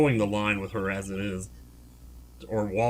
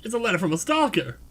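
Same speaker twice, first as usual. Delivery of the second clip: lightly voiced, higher-pitched self-important actor; self-important performer; 7 semitones higher than usual. Complaining of fame's problems, lightly self-important. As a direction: play a put-upon celebrity.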